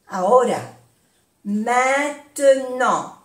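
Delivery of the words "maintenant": "Maintenant" stretched out slowly; slow syllables. Shown as a demonstration. Teacher repeating for learners, "Maintenant" slowly by syllable. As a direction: draw out each syllable of "Maintenant".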